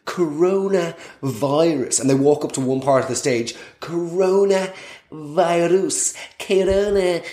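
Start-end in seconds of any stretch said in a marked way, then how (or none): 0.0-2.0 stand-up comic drawing out the catchword; milk the word slowly for a laugh; repeated catchword, stretched out for effect; Michael McIntyre. Acted. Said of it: "doing this Michael McIntyre thing". 3.8-7.2 very slow, exaggerated stand-up delivery; hammed-up catchword, hugely drawn out; Michael McIntyre. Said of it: "doing this Michael McIntyre thing".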